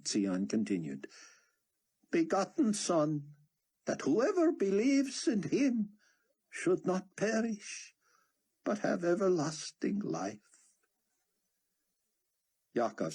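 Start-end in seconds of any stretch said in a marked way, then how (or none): 2.1-10.6 high, ringing preacher voice; preacher proclaiming scripture. A narrator's reading. Sion preaching the verse to the crowd, ringing and high.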